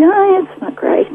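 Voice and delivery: high, breezy shrug at bad news; playing it down, high and airy; breezy understatement. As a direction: toss it off lightly, voice jumping up. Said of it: "I'm like".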